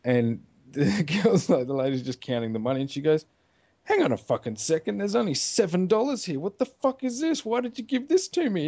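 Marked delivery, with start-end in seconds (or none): 3.8-8.7 high, outraged woman's complaint; indignant protest. Retold or imitated. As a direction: complain in mounting, high-pitched disbelief. Acted woman protesting the cash.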